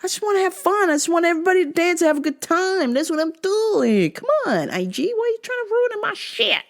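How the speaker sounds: high whining rant; whiny high complaint. Real, not acted.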